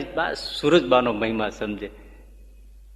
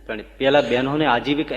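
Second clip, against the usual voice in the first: usual speaking voice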